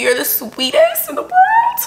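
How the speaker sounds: high squeal with a laugh; gushing. Real, not acted. Vlogger thanking the friend who sent gifts, gushing.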